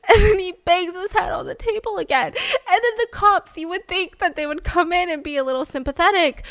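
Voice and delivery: high, laughter-laced narration; laughing. Real, not acted. Host continuing the story to her co-host, in real laughter. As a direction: try to keep telling it while laughing.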